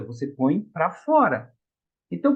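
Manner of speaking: normal talking voice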